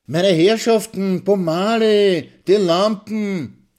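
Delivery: high, loud, long-drawn cry over a brawl; waiter calling out. A narrator's reading. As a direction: call out slowly, stretching every word. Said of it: "Nur die Stimme des Kellners". (lang gezogener, lauter Kellnerruf)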